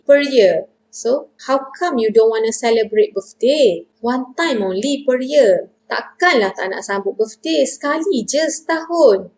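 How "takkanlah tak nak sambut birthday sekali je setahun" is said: takkanlah in disbelief, pitch lifted sharply on "sekali je setahun"; doubtful intonation. Shown as a demonstration. Teacher models doubting Nini's refusal of a birthday, incredulous intonation. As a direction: question it in doubt, swinging higher for "sekali je setahun". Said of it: "That's the intonation".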